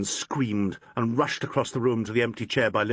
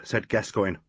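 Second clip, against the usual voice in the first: ordinary delivery, nothing marked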